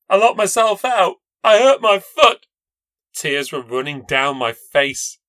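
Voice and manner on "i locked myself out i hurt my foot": higher, louder upset voice; tearful. A narrator's reading. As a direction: blurt it out through tears. Injured narrator tearful to neighbour.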